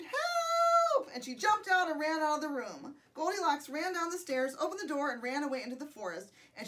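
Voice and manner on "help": very high, loud, long cry; scream for help. A narrator's reading. Goldilocks flees the three bears, screaming for help. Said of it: "She screamed".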